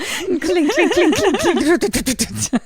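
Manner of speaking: high, slow, giggly clink clink sounds; squeaky clinks through a laugh; imitated cup clinking. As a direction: mimic a teacup rattling in a shaking hand. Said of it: "her hand is shaking so much that she's just like".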